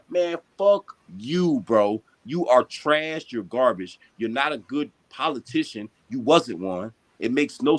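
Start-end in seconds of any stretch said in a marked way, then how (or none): none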